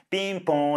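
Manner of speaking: high, sung-out two-tone chime; doorbell imitation. Doorbell rings while they put groceries away, imitated chime.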